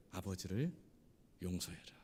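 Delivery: low, soft, grave instruction; solemn quiet command. Retold or imitated. God tells the man to forgive, low and quiet.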